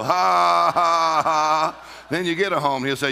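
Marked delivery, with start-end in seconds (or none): 0.0-1.8 loud, higher forced ha's; defiant demonstrated laugh. Shown as a demonstration. Answering the devil about owning a home, forced laugh. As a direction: throw out a big deliberate laugh. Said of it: "You go".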